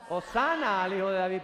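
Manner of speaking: a weak shout that falls short; half-hearted shout. Shown as a demonstration. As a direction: call out Hosana without fully letting go. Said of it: "eso no es un grito".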